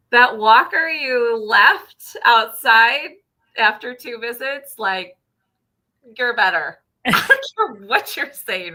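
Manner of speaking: loud, slow, pointed insistence; emphatic insistence. Retold or imitated. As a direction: drive the point home firmly. Emphatic to patient.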